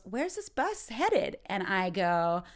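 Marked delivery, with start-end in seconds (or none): 0.0-1.4 high, slow imitation of a woman asking; woman on bus. Woman on the bus asks where it is headed, imitated high voice. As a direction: put on a higher voice for her question. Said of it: "this woman, she goes".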